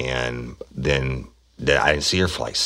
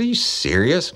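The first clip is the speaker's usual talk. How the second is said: incredulous boss; 12 semitones higher than usual. Retold or imitated. Boss surprised at bike tow.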